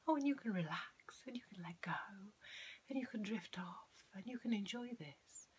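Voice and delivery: high, floaty lilt with no downward inflection; wispy, flowery suggestion voice; airy-fairy hypnotist. Shown as a demonstration. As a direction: drift airily through each suggestion, never landing it. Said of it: "example of how not to do it".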